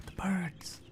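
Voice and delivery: gasp, then a soft whisper; hushed wonder. Real, not acted. Player suddenly noticing birds in the game, hushed wonder. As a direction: whisper it on a caught breath. Spots birds after being trapped.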